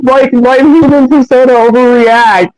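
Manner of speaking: ordinary delivery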